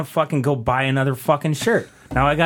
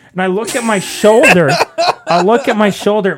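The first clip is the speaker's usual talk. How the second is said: higher, louder disgusted realization; real disgust building; louder, higher with a laugh; building outrage; 6 semitones higher, 6 dB louder than usual. Real, not acted. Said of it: "repeated for effect".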